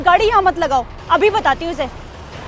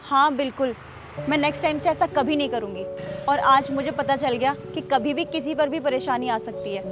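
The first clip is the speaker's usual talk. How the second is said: quietly owning up to a big mistake; remorseful apology; 4 semitones lower, 7 dB quieter than usual. Acted. Woman softly begs the guard and neighbour for forgiveness, remorseful.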